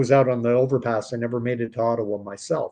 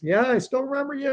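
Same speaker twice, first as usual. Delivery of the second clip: much higher-pitched retold reply; retold friend's reply; 11 semitones higher than usual. Old friend answering on Facebook, retold high-voiced reply.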